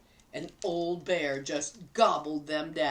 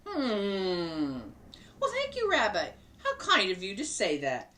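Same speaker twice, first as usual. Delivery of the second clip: grandma Bubba. Acted. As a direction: play the kindly grandma talking to her guest. Bubba speaks to bear.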